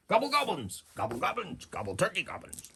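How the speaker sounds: high gobbling goblin babble, dropping at the end; turkey-like gobble, starting high; goblin chieftain. Acted.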